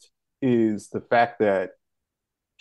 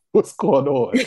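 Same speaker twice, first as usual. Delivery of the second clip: laughing; 3 semitones higher, 5 dB louder, slower than usual. Retold or imitated. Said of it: "I'm like".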